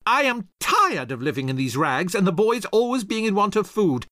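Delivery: high, loud furious wife; angry shout. Acted. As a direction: yell it at the useless husband. Rachel furious at husband.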